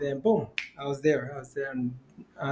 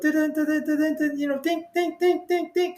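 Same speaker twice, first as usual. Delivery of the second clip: rapid high-pitched monitor beeps; high, repetitive machine-like pulses; imitated heart monitor; 13 semitones higher than usual. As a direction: mimic an alarm racing in a high voice. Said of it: "My heart rate and the monitor went".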